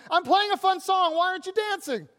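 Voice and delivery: put-on high, loud childish yell; mock yell. Kid in the marketplace yelling at friends, mock yell. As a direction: shout it like a bored kid who's been ignored. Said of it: "you start yelling at them".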